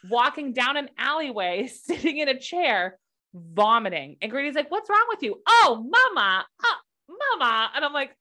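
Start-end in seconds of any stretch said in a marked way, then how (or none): 4.7-5.4 put-on high child voice, much higher; mock little boy asking what's wrong; imitating her son. Mother mimics her young son asking what's wrong, high childlike. 5.5-7.7 put-on high, loud childish whine; mock little boy whining Mama; whiny son. Mother imitates her son calling out to her, loud childish whine.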